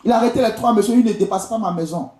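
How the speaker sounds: ordinary talk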